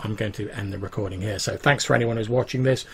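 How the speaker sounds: usual speaking voice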